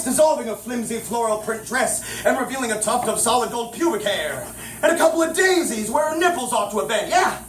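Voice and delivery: ordinary delivery, nothing marked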